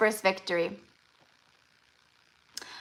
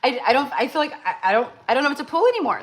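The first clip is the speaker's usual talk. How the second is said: flustered joy; 5 semitones higher, much faster than usual. Real, not acted. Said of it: "I'm like".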